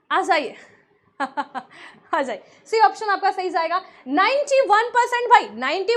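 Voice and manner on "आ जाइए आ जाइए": laughing. Real, not acted. Laughing while calling students.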